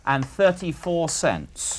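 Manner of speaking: slow, measured amount; slow dictation. Real, not acted. Questioner completing the dictated profit, slowly. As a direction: finish the figure at writing speed. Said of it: "I'll say it slowly".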